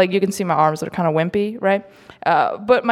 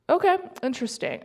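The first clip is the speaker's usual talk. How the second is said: higher, quick, softer doubt; skeptical okay; 8 semitones higher, 5 dB quieter, faster than usual. Retold or imitated. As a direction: raise an eyebrow at the name. Reacting to his name Moses.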